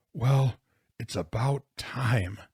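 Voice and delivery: slow, hoarse, halting words. A narrator's reading. Jonathan greeting the long-awaited snowplow, hoarse. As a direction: say it hoarsely, taking your time. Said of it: "he said in a hoarse voice".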